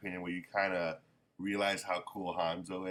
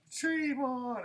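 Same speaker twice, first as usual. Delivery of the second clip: much higher, sing-song mock taunt. Hanzo mocking Gon just before the uppercut, sing-song taunt. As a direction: toss it off as a cheeky brush-off.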